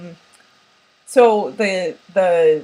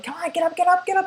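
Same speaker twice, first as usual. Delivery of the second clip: urging friend; 11 semitones higher, faster than usual. Retold or imitated. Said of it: "they're like".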